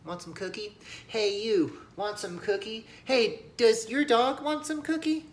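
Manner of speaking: put-on high, sweet kid offering; cheerful little boy. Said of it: "he goes".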